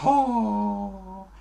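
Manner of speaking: long, high, soft "ho" after a tongue twister; mock exhausted sigh. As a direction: let out a drawn-out puff of relief. Nach sehr langem Wort. (gespieltes, langes Aufatmen)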